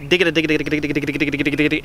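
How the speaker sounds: rapid rhythmic dig-a-da syllables; vocalized feed call chatter. Shown as a demonstration. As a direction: rattle it off like a duck feeding. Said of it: "a fast feed call".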